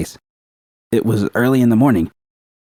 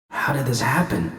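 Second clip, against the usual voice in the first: dazed whisper; 3 semitones higher, 6 dB quieter than usual. Acted. V dizzy, near collapse.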